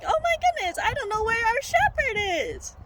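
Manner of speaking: very high, hammed-up panicky sheep; sheep realizing, panicked. Sheep realizes its shepherd is missing, high mock panic. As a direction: squeal it out like a comically worried sheep. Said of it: "they started realizing".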